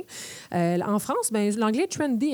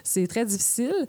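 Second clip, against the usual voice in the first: ordinary talk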